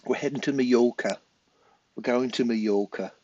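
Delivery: mock an English tourist saying Majorca; a hint of a Brit abroad heading off on holiday; English holiday-maker accent. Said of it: "In England, we go".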